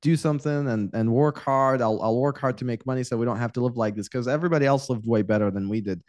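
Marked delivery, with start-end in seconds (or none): none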